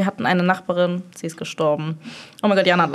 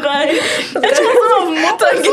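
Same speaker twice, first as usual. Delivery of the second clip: much higher, squealing through helpless laughter; can barely get words out for laughing; high-pitched real laughing fit, half crying; 12 semitones higher, 3 dB louder, slower than usual. Real, not acted. Laughing fit during her story.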